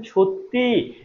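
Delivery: সত্যি with a long, high final ই; teacher sounding out the ending ই in সত্যি; demonstrated pronunciation. Shown as a demonstration. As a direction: stretch the closing "ee" of সত্যি. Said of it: "মুখে যখন উচ্চারণ করছেন".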